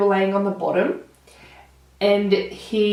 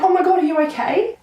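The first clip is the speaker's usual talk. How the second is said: much higher, fast panicked cry; alarmed; 11 semitones higher, 3 dB louder, faster than usual. Retold or imitated. To her brother just hit in the head, high alarmed cry. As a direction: blurt it out in shock, pitch way up.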